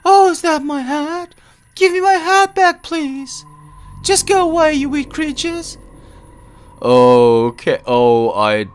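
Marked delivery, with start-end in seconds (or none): 0.0-5.8 put-on much higher, louder girlish plea; cute high voice. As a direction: shoo them away in a little girl voice. Voices game character.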